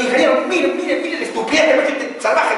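high loud retold protest; mock furious appeal, high and loud; indignant complaint. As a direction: imitate the architect ranting about the builder.

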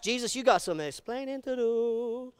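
teasing, pointed jab; sassy challenge. Pretend. Preacher teasing Jesus on behalf of critics, sassy. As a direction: call Jesus out with mock sass.

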